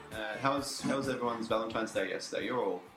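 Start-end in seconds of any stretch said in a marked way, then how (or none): none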